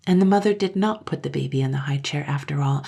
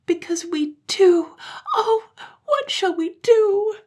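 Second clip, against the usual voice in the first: slow, very high wail; acted childish despair, drawn out; crying children; 5 dB louder, slower than usual. A narrator's reading. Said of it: "they cried together".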